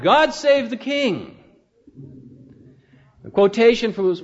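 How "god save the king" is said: high, drawn-out royal acclamation; restrained imitation of a victory cry; joyous victorious shout. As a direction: proclaim it slowly, as for a king. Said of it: "victorious shout".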